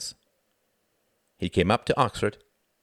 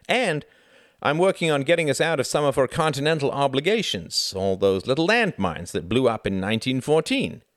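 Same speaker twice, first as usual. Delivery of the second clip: high, hearty, animated character voice; enthusiastic Frederick; 7 semitones higher, 3 dB louder than usual. A narrator's reading. Frederick sells diplomacy to doubtful Reginald, high and enthusiastic. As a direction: enthuse in a raised voice.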